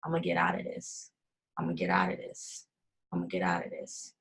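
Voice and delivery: hushed, low inner voice; inner thought. Retold or imitated. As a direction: breathe it like a private vow. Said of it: "on the bed thinking".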